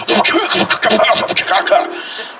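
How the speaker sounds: choking, stuttering van sputter; vocal imitation of a stalling van; sputtering engine noise. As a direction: imitate an engine coughing and dying.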